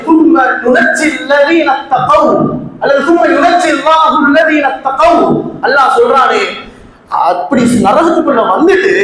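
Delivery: impassioned, challenging sermon delivery; preaching. Acted. Preacher challenges the congregation on escaping hell, preaching. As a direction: press the listeners with emphatic questions.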